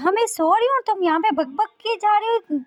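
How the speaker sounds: high, loud irritated scolding; angry outburst. Acted. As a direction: snap angrily at a noisy roommate. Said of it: "गुस्से में बोलती है".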